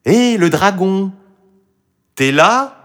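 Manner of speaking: put-on high, loud girl's call. A narrator's reading. Marie calls to the dragon behind the door, high and loud. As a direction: call boldly through a closed door. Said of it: "Elle appela".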